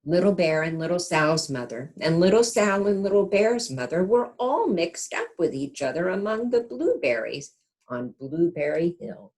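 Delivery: gentle storybook narration; reading to children. A narrator's reading. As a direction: read it like a bedtime picture book. Reads picture book aloud.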